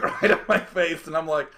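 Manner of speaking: ordinary delivery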